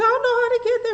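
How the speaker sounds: acting out panic, high and loud; panicked. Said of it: "I was just so panicky".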